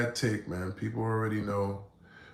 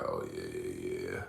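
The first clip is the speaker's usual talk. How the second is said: deep, slow, lowered "Oh, yeah"; sly predator; 9 dB quieter, slower than usual. Retold or imitated. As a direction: savour the prey, low and drawn out. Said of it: "they be like".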